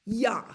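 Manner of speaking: Ja, German yah. Shown as a demonstration. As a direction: model Ja for kids to repeat. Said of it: "Can you say ja?".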